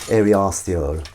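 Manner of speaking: airy arsehole as he used to say it; old mispronunciation. Retold or imitated. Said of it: "as I used to pronounce it".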